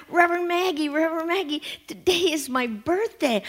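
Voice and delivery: put-on Johnny running up to the minister, eager child voice; excited little boy. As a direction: call out like a small boy bursting with news. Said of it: "Johnny comes running up".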